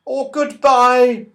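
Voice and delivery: slow, high, loud farewell call; calling goodbye. Acted. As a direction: sing out the farewell. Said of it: "called Silky".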